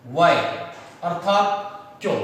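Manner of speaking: why said slowly and clearly as वाई; careful pronunciation. Shown as a demonstration. Teacher showing his class how to say वाई, careful and slow. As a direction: spell out वाई with a clean English why. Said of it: "इसका जो pronunciation होता है".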